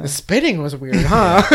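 giggling through a teasing imitation; laughing mock quote. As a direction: tease it while laughing. Mock viewer leaving the theater.

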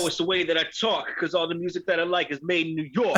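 put-on high, playful New York accent. Jokingly excusing the slip by music from New York, New York accent. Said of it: "accidental accent".

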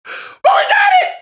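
high, loud joyous yell; ecstatic shout. Retold or imitated. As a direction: scream it like you can't believe it. Onside kick recovered, celebrating.

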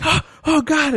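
high panicked cry; mock panic. Burning man crying out, high mock panic. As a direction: yelp as if on fire. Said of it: "he's just like".

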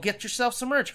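ordinary delivery

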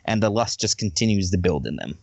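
ordinary delivery

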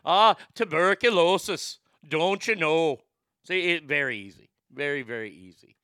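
hammed-up Irish lilt; Irish accent. Shown as a demonstration. Host shows how easy the trick is, mock Irish accent. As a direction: put on a playful stage-Irish accent. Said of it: "you've got your Irish accent".

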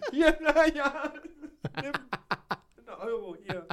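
giggling through the sales pitch; laughing seller. Pretend. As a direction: laugh while handing it over. Acting out selling shirts.

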